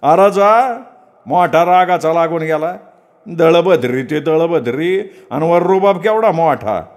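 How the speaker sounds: scornful jeer. Acted. Shopkeeper jeering at departing Datta, high scornful. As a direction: jeer at him as he walks out.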